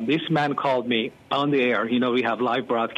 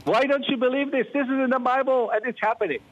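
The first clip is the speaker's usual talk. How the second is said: much higher, scolding rebuke; admonishing; 10 semitones higher, faster than usual. Retold or imitated. Caller admonishing the pastor for his surprise, higher and scolding. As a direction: tell him off for not believing. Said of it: "So I was admonished by him".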